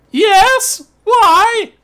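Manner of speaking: put-on high, loud old lady squawk; old grandma voice. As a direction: chirp it back innocently. Grandma answering orc at door.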